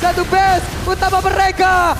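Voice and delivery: loud, excited play-by-play shouting; esports caster hype. Real, not acted. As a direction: call the teamfight at full hype.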